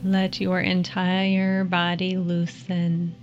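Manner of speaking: calm them down gently, unhurried. Acted. Leads a guided meditation.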